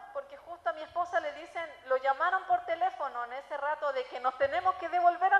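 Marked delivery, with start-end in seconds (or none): none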